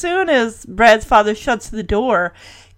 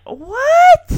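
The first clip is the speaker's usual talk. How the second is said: very high, loud incredulous "what"; utterly scandalized yelp; outraged disbelief; 5 dB louder than usual. Real, not acted. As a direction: shoot the pitch way up in disbelief. Host's own outraged reaction to retold line.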